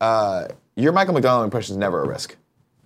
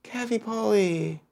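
high cooing pet name; 9 semitones higher, 6 dB quieter, slower than usual. Acted. Coaxing friend by pet name.

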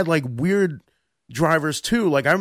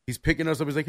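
ordinary talk

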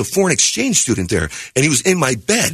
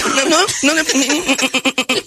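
mom refusing; 11 semitones higher, much slower than usual. Retold or imitated.

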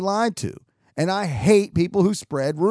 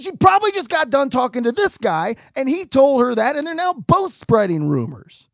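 high, loud, worked-up retort; indignant accusation; 8 semitones higher, 5 dB louder than usual. Retold or imitated. Accusing boss and man of spreading rumors, indignant. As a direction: accuse them both in acted outrage.

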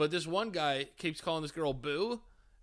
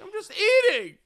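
squeaky loud dopey excuse; mock caught creep, loud and high; high, loud mock innocence; 14 semitones higher, 11 dB louder than usual. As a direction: play dumb in a high loud voice. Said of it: "He's like".

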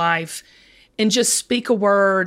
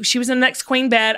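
ordinary delivery

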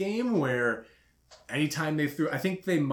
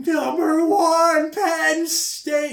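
high, drawn-out bellow at a distant player; heckler's yell; 11 dB louder, much slower than usual. Retold or imitated. Imitating a fan heckling a Penn State player, yelling. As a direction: yell it slowly across a whole stadium. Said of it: "he just kept yelling".

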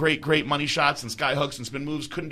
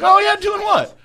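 very high, loud retold disbelief; retold amazed reply, pitched way up; mock astonishment; 8 dB louder than usual. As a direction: squeak the question in exaggerated surprise. Acts out his amazed reply.

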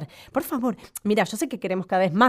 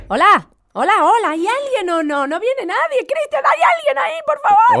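high, loud calls into the room; calling out; 12 semitones higher, 6 dB louder than usual. Real, not acted. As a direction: call out for anyone there. Host calls out into the empty studio.

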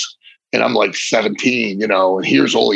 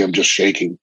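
ordinary talk, nothing marked

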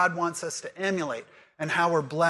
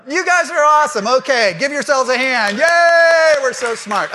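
excited cheering; 7 semitones higher, 12 dB louder than usual. Real, not acted. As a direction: cheer them on at full volume. Praising the class after quiz.

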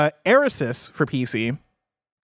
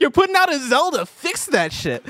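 exasperated; 11 semitones higher than usual. Real, not acted. Mock outrage at Nintendo.

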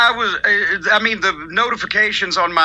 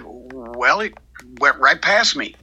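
sheepish boss; 8 semitones lower, slower than usual. Retold or imitated. The boss admitting he missed the joke, low and sheepish. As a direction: drop low and sheepish, a little embarrassed. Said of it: "He said".

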